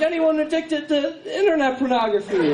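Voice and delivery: high, ringing preacher's question; preacher's call. Retold or imitated. Preacher calling porn addicts forward, high ringing voice. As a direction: ask it like a revival healer. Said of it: "he's like".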